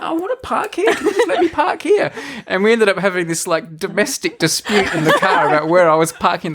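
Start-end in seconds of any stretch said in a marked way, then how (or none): 0.0-2.1 high, laughing whiny plea; mock pleading. Teller replays himself begging to park there, high and whiny. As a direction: whine it like a stubborn kid. His own quote, pleading.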